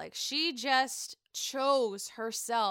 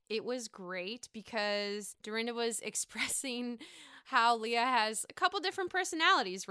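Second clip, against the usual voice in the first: usual speaking voice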